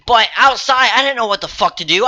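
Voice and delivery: ordinary talk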